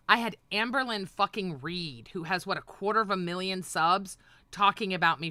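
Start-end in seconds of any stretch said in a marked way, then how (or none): none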